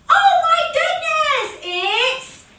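high loud gasp of surprise; shocked exclamation. Acted. Bunny realises it is Easter, high loud shock. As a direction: cry out, suddenly remembering. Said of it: "he remembers".

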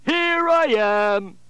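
high, loud and gleeful; triumphant reveal. Acted. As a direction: pop out with a big reveal. Dinosaur jumps out.

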